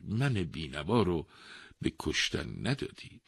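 loud, measured pleading explanation; earnest old man. A narrator's reading. Old man advising the wise king, earnestly pleading. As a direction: lay out the plan to persuade.

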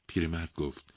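plain reading voice

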